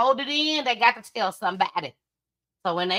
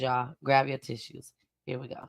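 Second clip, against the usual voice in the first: hushed lead-in; 8 semitones lower, 7 dB quieter than usual. Real, not acted. Leading into the tribute.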